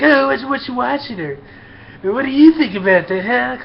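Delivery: high, prying mock voice; mock nosy coworker. Mimicking coworkers drawing him into their talk.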